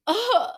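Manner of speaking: high, loud mock groan of disappointment; disappointed groan. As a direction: let out a big imitated groan of letdown. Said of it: "like, disappointment".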